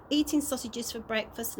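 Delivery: ordinary delivery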